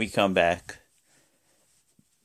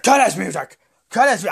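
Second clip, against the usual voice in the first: high, loud mock angry order; hammed-up furious boss yell; Vince; 8 semitones higher, 5 dB louder than usual. Boss ordering the music cut, mock fury. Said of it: "Vince is like".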